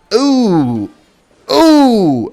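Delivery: high, loud "oh" swooping down; two mounting cries of excitement; excited whoops. Real, not acted. Reacting as the play lands.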